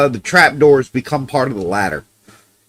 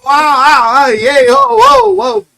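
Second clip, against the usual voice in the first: high, loud yelps of shock; jolted by a bark in his ear, yelping high and loud; startled shout; 8 dB louder, slower than usual. Real, not acted. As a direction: recoil and yell whoa. Dog's bark nearly bursts eardrum.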